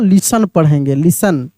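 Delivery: "लिसन" with no T sound; silent T. Shown as a demonstration. Teacher showing the reading, "लिसन" with silent T. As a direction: say "लिसन" as "lis-an", T dropped. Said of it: "टी का उच्चारण नहीं होगा".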